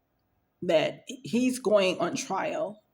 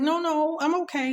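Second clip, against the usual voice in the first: retold polite brush-off, pitched up; higher-voiced, hurried-to-decline reenactment; uneasy refusal; 7 semitones higher than usual. Patient declining the orderly's massage, uneasy.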